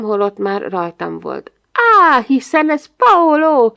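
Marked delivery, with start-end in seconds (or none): 1.7-3.7 loud, high cry of recognition; exclamation. A narrator's reading. Narrator pretending to recognise Paolo, loud exclamation. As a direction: cry out slowly in staged surprise. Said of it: "kiáltottam föl".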